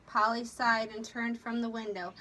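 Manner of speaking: usual speaking voice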